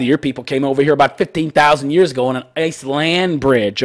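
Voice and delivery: ordinary talk, nothing marked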